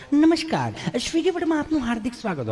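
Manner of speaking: mock call-centre woman; rushed operator greeting. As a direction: rattle off a hurried welcome. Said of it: "એક બેન તો અડધું સ્વાગત કરીને ગઈ છે".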